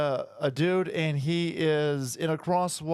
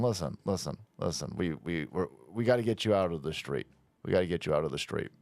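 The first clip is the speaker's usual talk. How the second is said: low quiet Italian voice; 10 semitones lower, 4 dB quieter, faster than usual. Retold or imitated. Italian men coaxing migrant off street, low quick plea. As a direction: lower and softer, hurried mock pleading. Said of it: "they're sitting there going".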